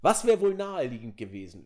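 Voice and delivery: ordinary delivery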